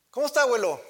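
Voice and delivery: high, loud cheerful grandchild voice; grandchild greeting. Retold or imitated. Grandchild calls grandfather to ask how he is, high cheerful greeting. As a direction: call out brightly like a grandkid on the phone.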